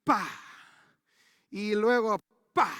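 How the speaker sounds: mimicked strikes. Imitating arrow striking ground.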